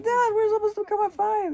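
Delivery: high-pitched protest to Dad, retold. Retells own protest to dad.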